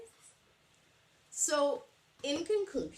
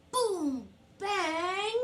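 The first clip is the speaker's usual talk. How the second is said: loud, higher boom and bang; drum noises; 6 semitones higher, 5 dB louder than usual. Acted. Teacher acting out bear drumming, loud. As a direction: bang it out like drums.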